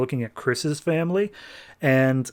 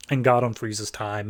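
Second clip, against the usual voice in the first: normal talking voice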